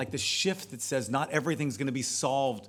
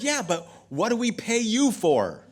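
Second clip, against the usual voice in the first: indignant challenge; 10 semitones higher, 7 dB louder than usual. Retold or imitated. Woman challenging pastor.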